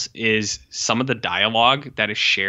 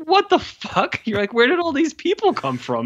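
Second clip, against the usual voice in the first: 1 shocked disbelief. Retold or imitated. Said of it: "you're just like".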